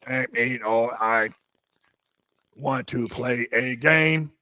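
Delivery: slow, creepy robotic drawl; slow mechanical game-master voice. Retold or imitated. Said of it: "it sounds like you're".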